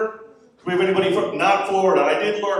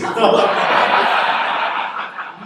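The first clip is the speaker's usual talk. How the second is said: laughing; 7 semitones higher, 6 dB louder than usual. Real, not acted. Laughs at own bit.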